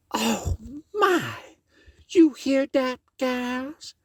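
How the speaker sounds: a high exclaiming lady. Acted. Miss Meadows answering Brer Tarrypin, high and exclaiming.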